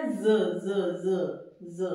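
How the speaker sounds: ज said low and repeated, a buzzing z; repeated z sound. Shown as a demonstration. Teacher drills the z sound for students, low. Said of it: "सेज मत पढ़ लेना".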